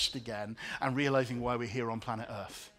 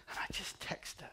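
whisper; 4 semitones higher, 9 dB quieter than usual. Real, not acted. Whispered aside.

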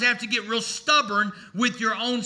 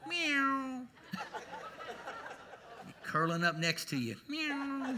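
a tiny, purring cat meow, softer; soft kitty meow; 3 semitones higher, 12 dB quieter, slower than usual. Pretend. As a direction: curl up like a cat and mew. Cat imitation, kitty cat on a cold night.

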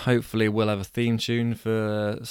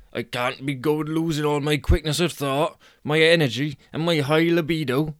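higher, louder put-on character voice; fretting Angus; 5 semitones higher, 4 dB louder than usual. Angus thinking as his pills run out, agitated. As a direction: fret aloud with raised pitch and force.

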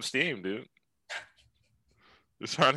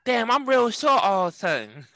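much higher Tyson voice, puzzled; Mike Tyson impression; 13 semitones higher than usual. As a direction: squeak it out like a baffled Tyson. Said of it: "Why I sound like this?".

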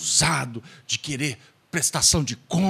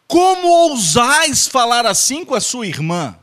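higher, louder theatrical outrage; hammed-up stage outrage; playfully grand indignation; mock lofty rebuke; 8 semitones higher, 5 dB louder than usual. Mock archaic rebuke, repeated.

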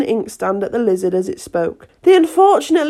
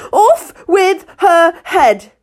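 high, slow, screaming command; shouting; 9 semitones higher, 7 dB louder, much slower than usual. A narrator's reading. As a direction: scream it at the top of the voice. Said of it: "shouted at the top of her voice".

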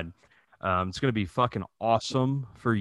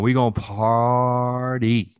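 party hype; 4 dB louder than usual. Acted. Hyping a party visit to Dallas.

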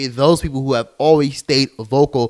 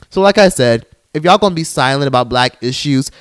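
ordinary talk, nothing marked

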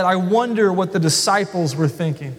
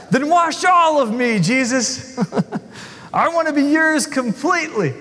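eager Peter; 6 semitones higher than usual. Acted. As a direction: overdo it with a laugh. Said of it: "Peter jumps too far".

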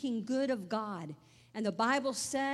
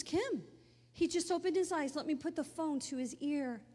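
higher, breathless good news; excited; 4 semitones higher than usual. Retold or imitated. Mother telling daughter her dad woke, excited and higher. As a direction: burst out with the news.

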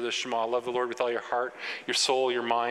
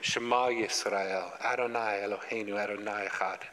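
concentrated prayer; 3 semitones lower than usual. Retold or imitated. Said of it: "They would pray it just like that".

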